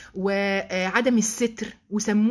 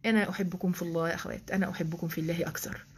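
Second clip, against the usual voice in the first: soft, lower loving aside; tender aside; 5 semitones lower, 7 dB quieter than usual. Real, not acted. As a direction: say it gently to the sisters. (بحنان وبصوت منخفض للأخوات)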